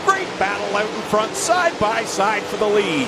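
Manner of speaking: lively live race play-by-play; motorsport commentator. Real, not acted. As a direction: call the lead battle with rising energy.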